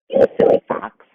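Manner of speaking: loud, whispered scolding; scornful whisper. A narrator's reading. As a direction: hiss it at the fox. Animals mock fox.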